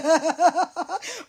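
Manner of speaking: brief soft laugh; quick laugh. Real, not acted. Laughs at joke.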